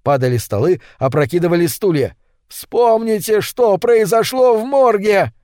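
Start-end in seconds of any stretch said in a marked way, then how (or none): 2.5-5.3 high, drawn-out cry; desperate appeal; urgent reminder. A narrator's reading. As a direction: call it out slowly over a fight, pitch held high. Shouted appeal in a fight.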